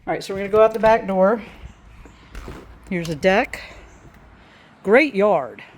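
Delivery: ordinary talk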